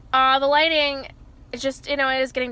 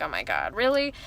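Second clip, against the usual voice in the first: imitating mom's reaction; 7 semitones lower than usual. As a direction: play the stunned mother. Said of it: "she's gonna be like".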